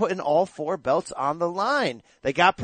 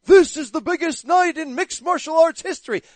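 booming, higher hype-announcer voice; 8 semitones higher, 6 dB louder than usual. Retold or imitated. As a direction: oversell it like a fight-night announcer. Mocking hype broadcast.

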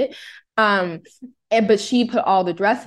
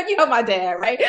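ordinary talk, nothing marked

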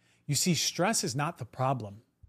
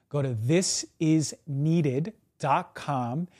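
slow URL read; 4 dB louder, much slower than usual. Acted.

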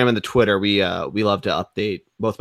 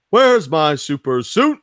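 drawn-out movie-quote yell; imitated yell; 4 semitones higher, 4 dB louder, slower than usual. Friend recalling Greg's high school catchphrase, mock yell. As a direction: holler it slowly, a bit higher. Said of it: "used to yell out".

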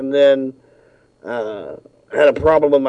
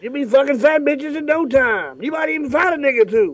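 Samuel L. Jackson voice; 10 semitones higher than usual. Pretend. Jackson commercial impression.

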